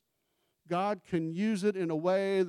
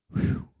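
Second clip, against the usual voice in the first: whew; 4 dB louder than usual. Real, not acted. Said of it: "I'm gonna get you home quick".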